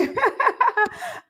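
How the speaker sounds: high-pitched giggle; laugh. Real, not acted. Host thanking a viewer for compliments, giggling high.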